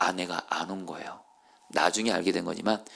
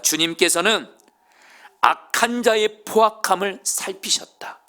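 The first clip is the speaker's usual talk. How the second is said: high, loud, slowed with weight; emphatic proclamation; 9 semitones higher, 4 dB louder, slower than usual. Acted. David declaring that God sees, slow and emphatic. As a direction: proclaim it slowly and strongly. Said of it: "다윗이 이렇게 말합니다".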